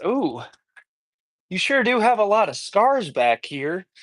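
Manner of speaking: high-pitched Southern lilt; Southern accent. Retold or imitated. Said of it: "I did a Southern accent".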